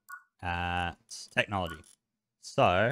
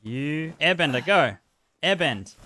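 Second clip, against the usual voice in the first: being chased; 6 semitones higher, 4 dB louder than usual. Real, not acted. Fleeing an attacking Grelephant.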